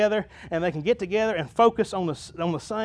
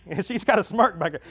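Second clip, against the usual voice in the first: ordinary delivery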